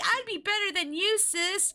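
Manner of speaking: high, mocking brag; boastful sister. Streamer mocking Chris hyping herself up, high brag. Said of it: "she was hyping herself up".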